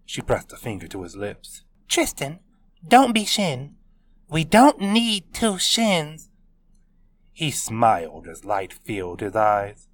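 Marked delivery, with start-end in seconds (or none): 1.9-6.3 Taylor voice. A narrator's reading. Taylor hushing Tristan.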